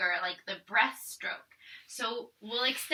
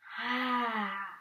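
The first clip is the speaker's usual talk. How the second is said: sigh; 5 dB quieter than usual. Shown as a demonstration. Breath count in yoga.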